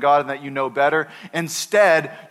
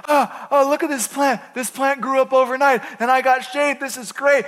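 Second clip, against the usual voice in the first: excited Jonah; 8 semitones higher than usual. Retold or imitated. Pastor voicing Jonah thrilled by his shade plant, high and giddy. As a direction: squeal with delight over the plant. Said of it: "He's like".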